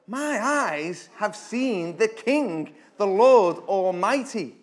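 loud, slow, pleading Isaiah; desperate confession. Acted. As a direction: confess your ruin in terror. Isaiah's confession before God.

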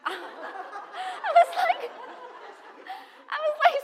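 very high, slow laughing; can't stop laughing. Real, not acted. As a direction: squeal with laughter. Laughing before the quote.

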